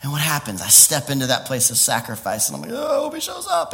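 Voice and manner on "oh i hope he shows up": very high, giddy exclamation; hammed-up nervous thrill; eager anticipation. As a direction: squeal it with acted hopeful excitement. Acts out eager waiting for God.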